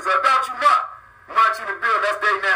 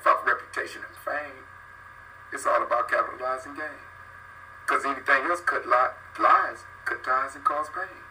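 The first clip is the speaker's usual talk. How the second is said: spoken-word rhyme; 7 semitones lower, 6 dB quieter than usual. Acted. Recites rhyming lines low and quiet.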